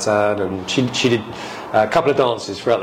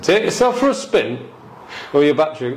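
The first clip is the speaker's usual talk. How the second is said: northern English accent, pitched up; 4 semitones higher than usual. Shown as a demonstration. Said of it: "I want to say that in a northern accent".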